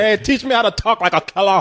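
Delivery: a light Scarface gangster voice; Pacino Scarface impression. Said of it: "coached by Al Pacino".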